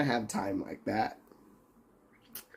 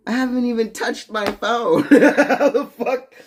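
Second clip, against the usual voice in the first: high, loud laughing protest; real laugh breaking into how the fuck; laughing disbelief; 10 semitones higher, 6 dB louder than usual. Real, not acted. Laughing at a timeout accusation.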